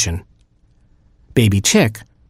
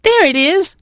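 very high chick squeak; Baby Chick. A narrator's reading. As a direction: pipe up as a tiny chick. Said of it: "said Baby Chick".

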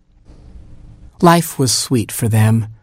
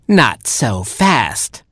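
very slow, drawn-out refusal; menacing creature; 2 semitones higher, much slower than usual. A narrator's reading. The creature stops the family leaving, menacing. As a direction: stretch each word to block their way. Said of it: "said the voice".

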